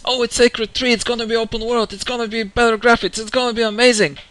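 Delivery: sarcastic imitation of hyped fans; mocking fans. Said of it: "Everyone thinks".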